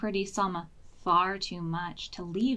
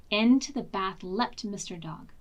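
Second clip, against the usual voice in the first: plain reading voice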